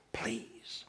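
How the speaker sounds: breathy whispered please, barely audible. Retold or imitated. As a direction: beg in a whisper. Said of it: "He's begging".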